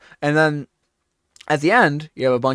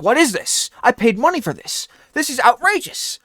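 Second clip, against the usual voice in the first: higher, indignant customer; mock outrage; 10 semitones higher, 3 dB louder than usual. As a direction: play the cheated buyer, hammed up. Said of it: "And you're like".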